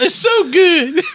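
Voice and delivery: high, slow Southern belle swoon; breathy high drawl as a swooning belle; Scarlett O'Hara impression. Co-host hams up Scarlett O'Hara for the listeners, high and slow. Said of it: "Vivian Leigh is spinning in her grave".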